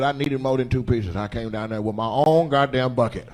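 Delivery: normal talking voice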